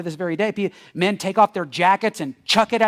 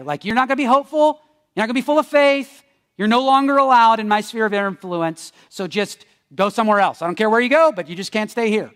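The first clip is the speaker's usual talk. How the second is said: raised, higher voice laying down the law; firm dismissal; 3 semitones higher, 4 dB louder than usual. Acted. Throwing hope-hijackers out of the room, firm mock telling-off. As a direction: send them packing, emphatically.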